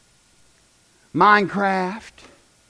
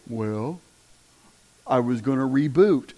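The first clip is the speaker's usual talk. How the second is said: much lower and softer, a meek excuse; sheepish kid; 9 semitones lower, 5 dB quieter than usual. Retold or imitated. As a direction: mumble it like a caught kid. Kid's lame excuse.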